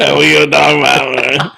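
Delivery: a hint of a Cajun football coach; Coach Ogeron impression. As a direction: bark it like a Louisiana coach. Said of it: "Is that Coach Ogeron".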